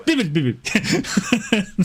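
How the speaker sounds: slow, high, laughing fake Italian babble; laughing through fake Italian; mock Italian gibberish. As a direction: ham up nonsense Italian while cracking up. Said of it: "That's Italian".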